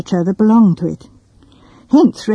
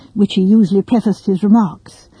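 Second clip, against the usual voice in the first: usual speaking voice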